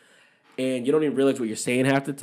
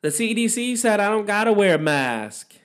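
much higher, a bit louder [name] imitation; [name] meme voice; 9 semitones higher, 4 dB louder than usual. Joking about masks, in a high [name] meme voice. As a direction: squeal it like the little-kid [name] meme. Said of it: "I always feel like".

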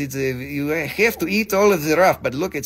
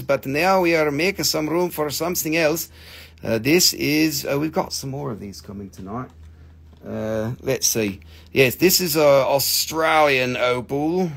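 ordinary delivery

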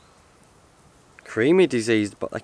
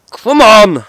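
very loud, high victory shout; triumphant roar; 9 semitones higher, 12 dB louder than usual. Real, not acted. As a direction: roar it out in celebration. Celebrating after downing the beer.

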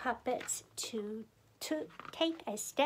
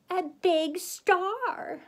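slow, higher, louder wonder; dramatic reveal; 5 semitones higher, 6 dB louder, slower than usual. A narrator's reading. Narrator revealing what was in the box, dramatically. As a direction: unveil it grandly, stretching the words.

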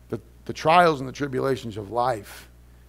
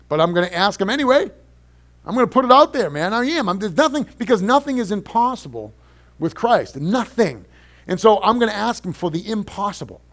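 fervent preaching, higher and louder; 7 semitones higher, 8 dB louder than usual. Acted. Pastor vowing to the congregation in fervent preaching. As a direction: preach the promise with fire.

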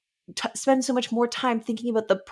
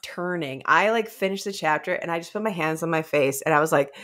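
normal talking voice

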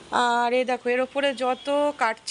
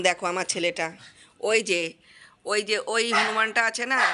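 usual speaking voice

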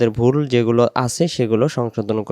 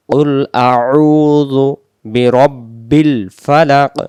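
very slow, louder model recitation; Quran recitation; 5 dB louder, much slower than usual. Shown as a demonstration. Teacher modelling the phrase for learners, slow recitation. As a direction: hold the long vowel in আউযু.

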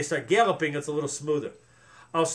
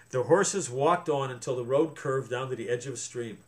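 plain reading voice

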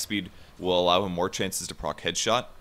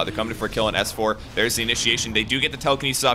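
louder, higher live caster hype; excited play-by-play; 3 semitones higher, 5 dB louder than usual. Real, not acted. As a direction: call the gank fast and building. Live kill call.

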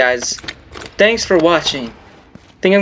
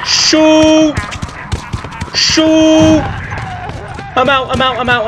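panicked shouting; 10 semitones higher, slower than usual. Real, not acted. Gamer chased through the dark, real terrified shouts. Fleeing monsters in horror game.

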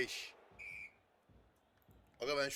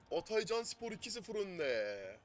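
football commentator; 6 semitones higher, slower than usual. Pretend. Commentator-style score call.

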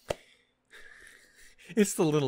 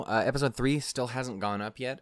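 normal talking voice

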